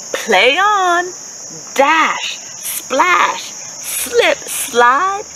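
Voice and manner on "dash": ordinary delivery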